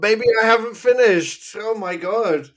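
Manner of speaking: loud, high-pitched playful outrage; mock indignation. Reviewer told "let's move on" by co-host, mock indignant. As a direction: protest loudly, as if deeply affronted. Mock offence at being hurried along.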